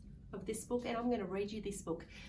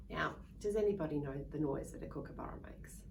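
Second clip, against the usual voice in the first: plain reading voice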